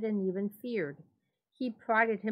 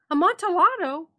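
very high, very loud exclamation; excited Fortunato; 11 semitones higher, 11 dB louder than usual. A narrator's reading. Drunk friend obsessed with the wine, very loud cry. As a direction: shriek the word in delight. Fortunato repeats word, amazed.

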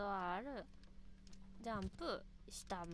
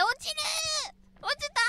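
high yell; 12 dB louder, slower than usual. Real, not acted. Falling, high drawn-out cry.

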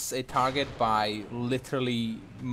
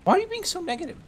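mock whine; 4 dB louder, much faster than usual. Real, not acted. Teasing teammate's pessimism.

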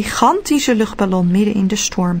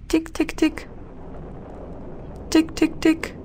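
quiet, high tapping; soft tapping; 7 semitones higher, 7 dB quieter than usual. A narrator's reading. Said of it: "Het geluid".

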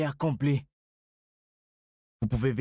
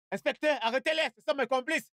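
high, urgent outcry; panicked high-pitched plea; frantic accusation; 11 semitones higher, faster than usual. Acted. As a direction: call out desperately to the inspector. Arrested man frantically denouncing accomplices.

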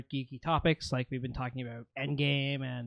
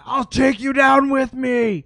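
high, very loud battle shout; dying ogre's roar; 10 semitones higher, 10 dB louder than usual. Acted. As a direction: roar a last threat as you lunge. Ogre's last lunge at Veo.

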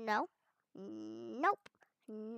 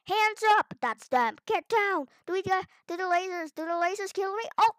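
normal talking voice

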